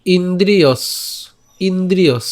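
ইন্দ্রিয়স said slowly, ending on a clear dental s; Sanskrit pronunciation demo. Shown as a demonstration. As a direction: sound out ইন্দ্রিয়স, stopping on the dental s. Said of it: "আমি এখানে দন্তাস পর্যন্ত উচ্চারণ করলাম". (দন্ত্য স পর্যন্ত ইন্দ্রিয়স উচ্চারণ)

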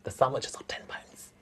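hushed whispered aside. Real, not acted. [name] confiding to Nicola about the dear sandwiches, whispered. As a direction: lean in and whisper the price.

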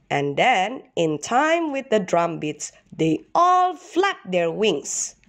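ordinary delivery, nothing marked

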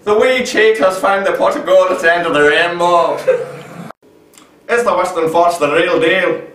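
high, lively put-on Irish brogue; Irish accent. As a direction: ham up an Irish accent for the intro. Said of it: "You can't do an Irish accent for shit".

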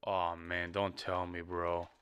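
low, soft, disbelieving plea; quiet dismay. Real, not acted. Reactor learns the musician has died, quiet dismay. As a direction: softly take in bad news, voice sunk low.

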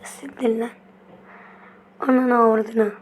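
ordinary talk

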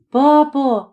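a hint of a young child; lightly voiced child. A narrator's reading. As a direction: call out to papa like a little boy. Small boy calling his father.

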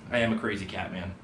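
ordinary delivery

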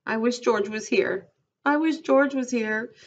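put-on high, childlike whine; whining. A narrator's reading. Lenny longs for George during the quarrel, whining. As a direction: plead helplessly, pitch climbing on the repeat. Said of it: "Lenny whined".

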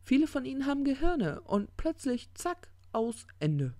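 high-pitched, dramatic build to a drop; agitated doom-telling; ranting demon. Acted. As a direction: pitch it up, then drop hard on the end. Crowley rants about the end.

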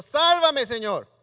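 loud, urgent sálvame; loud plea for rescue. Acted. Pedro calling to the Lord from the waves, loud and urgent. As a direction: call out as you sink.

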